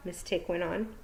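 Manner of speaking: ordinary delivery, nothing marked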